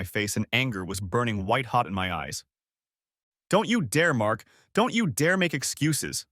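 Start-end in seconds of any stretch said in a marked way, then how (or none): 3.5-6.3 higher, slow furious hiss; angry hiss. A narrator's reading. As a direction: spit each word out in controlled fury. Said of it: "I hissed".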